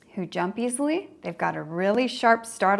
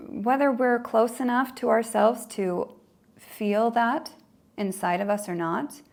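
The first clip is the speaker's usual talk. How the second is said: ordinary talk, nothing marked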